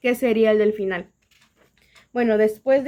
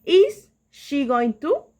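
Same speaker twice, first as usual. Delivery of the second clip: slow English question; 2 semitones higher, 3 dB louder, much slower than usual. Shown as a demonstration. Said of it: "tenemos el".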